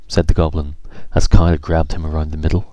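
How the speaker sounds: ordinary delivery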